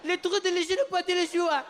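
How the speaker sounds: high, laughing mock French child. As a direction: gibberish French as a small boy. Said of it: "he's like".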